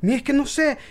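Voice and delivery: high-pitched retold plea to be believed; insistent protest. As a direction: act out protesting that you mean it. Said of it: "Y yo".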